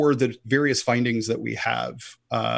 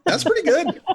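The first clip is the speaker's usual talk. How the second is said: high-pitched, louder, laughing reply; 4 dB louder than usual. Real, not acted. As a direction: say it through real laughter. Amused reaction to low percentage.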